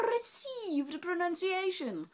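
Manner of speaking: high, slow put-on RP; mock RP accent, drawn out high; Received Pronunciation accent. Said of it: "speak in what English majors know as".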